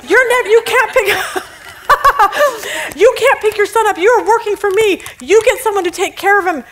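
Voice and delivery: high scornful boss with laughs; mocking boss. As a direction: scoff at him while laughing. Boss berating the employee.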